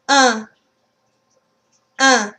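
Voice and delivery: "Un" as a pure nasal vowel. Shown as a demonstration. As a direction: say "Un" through the nose, no N. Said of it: "Let's practice a few words for that one".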